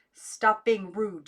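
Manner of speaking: a slapping aunt shouting it; slow loud shout. A narrator's reading. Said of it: "she shouted".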